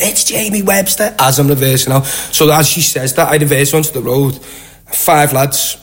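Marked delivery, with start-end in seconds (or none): 0.0-1.1 high, drawn-out mimicked answer; slow, high-pitched impersonation; imitated woman's reply. Women answering the grandmother, voiced.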